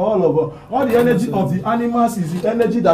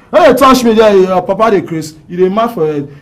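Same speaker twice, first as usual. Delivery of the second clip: angry scolding; 8 dB louder than usual. Acted. Acted scolding of someone.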